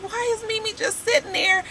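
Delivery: high, hushed disbelief; puzzled high murmur to herself; baffled aside. Retold or imitated. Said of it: "I was just like".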